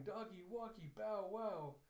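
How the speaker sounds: put-on high singsong child voice; happy little girl. Child out walking with the doggy, happy singsong. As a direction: chant it like a kid skipping along. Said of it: "just happily skipping along".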